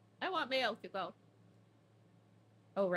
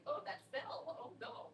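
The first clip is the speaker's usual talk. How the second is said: softer, higher, lightly voiced feigned innocence; mock innocent cats; 5 semitones higher, 5 dB quieter than usual. Voicing the nonchalant cats after knocking the rack, mock innocent. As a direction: play the cats shrugging it off innocently.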